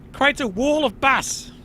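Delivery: usual speaking voice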